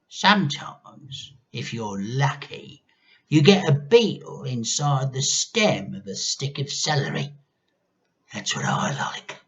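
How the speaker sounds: grandma relishing disgusting food; relishing old hag. A narrator's reading. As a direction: savour the word like a witch.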